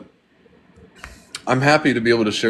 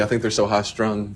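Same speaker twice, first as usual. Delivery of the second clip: ordinary talk, nothing marked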